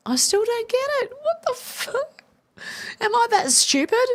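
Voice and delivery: high, squeaky bewildered outburst; can't believe she still doesn't get it; baffled disbelief. Real, not acted. Streamer reading chat, baffled and squeaking in disbelief.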